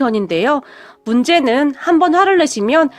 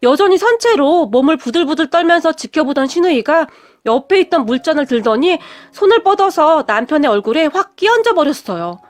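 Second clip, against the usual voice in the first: plain reading voice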